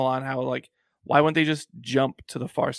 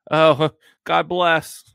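mock blessing; 3 semitones higher, 7 dB louder, much slower than usual. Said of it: "like saying to a known atheist".

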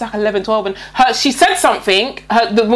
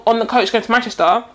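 usual speaking voice

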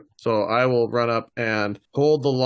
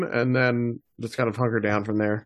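usual speaking voice

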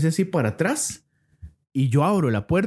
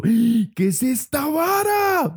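high slow frightened question; spooked, voice stretched; 12 semitones higher, much slower than usual. Retold or imitated. Retelling fright at creature.

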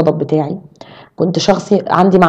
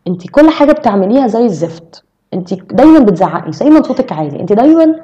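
higher-pitched accusations, pouncing on each fault; harsh criticism; 3 semitones higher than usual. Shown as a demonstration. Criticizing a friend for always shouting, harsh and accusing. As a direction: demonstrate tearing someone down, pitch jumping up. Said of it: "الانتقاد إن أنا أهدمك، أو إن أنا أقولك".

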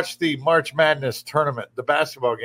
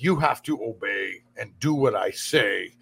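mock bossy left; 8 semitones lower, 4 dB quieter than usual. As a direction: lower voice imitating a commanding ideologue. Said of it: "It's the left that says".

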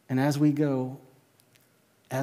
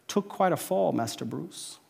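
put-on English butler accent; 7 dB quieter than usual. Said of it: "that English accent".

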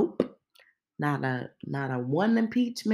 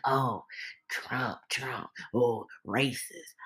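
mocking critics; 5 semitones lower, 5 dB quieter, slower than usual.

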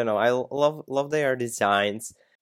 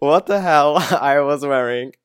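laughing disbelief; 4 semitones higher, 8 dB louder than usual. Real, not acted. Laughing at old photos.

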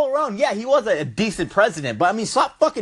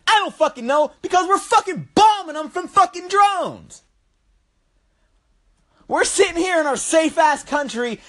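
angry rant; 8 semitones higher than usual. Real, not acted. Ranting about drone strikes.

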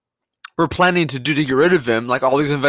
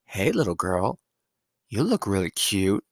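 low, soft mock creep voice; lightly voiced creepy come-on; hushed mock leer at a child; creepy imitation; 7 semitones lower, 7 dB quieter than usual. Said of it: "really creepy vibe about it".

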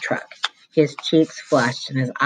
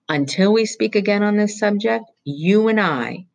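higher, sneering schoolmarm voice; acid, suspicious interrogation; stern vice principal; 7 semitones higher than usual. Acted. Vice principal threatening Roy with truancy police, acidly.

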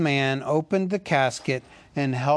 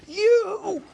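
much higher, louder enraged shout; furious cry; 5 dB louder than usual. A narrator's reading. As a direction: explode at the hobbit. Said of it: "cried Thorin".

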